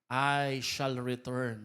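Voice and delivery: lightly voiced famous declaration; slow, low quote of a general's promise; MacArthur. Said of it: "Famous po nung sinabi niya".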